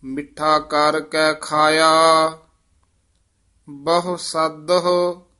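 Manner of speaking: measured, sustained verse recital; scripture recitation. Acted. Teacher reciting scripture for students, measured recitation. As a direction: recite sacred verse with drawn-out line endings.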